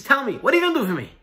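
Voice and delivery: high, rapid demand; impatient insistence. Acted. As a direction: cut in and press for an answer, fast. Father insists on an answer.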